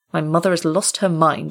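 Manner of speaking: plain reading voice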